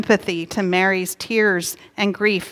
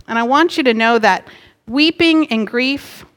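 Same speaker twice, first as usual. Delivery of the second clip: normal talking voice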